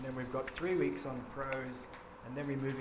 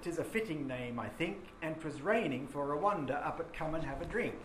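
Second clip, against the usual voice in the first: reading the ballad stanza aloud with a lilt; rhythmic verse reading; poem recital; 3 semitones higher than usual. Shown as a demonstration. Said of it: "just listen to the first stanza".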